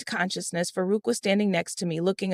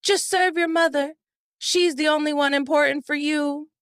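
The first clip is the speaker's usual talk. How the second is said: raised, resentful jab at his wife; sharp jealous reproach, pitched up; angry retort; 8 semitones higher than usual. A narrator's reading. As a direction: snap it out bitterly, voice pitched high. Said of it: "glared at me angrily and said".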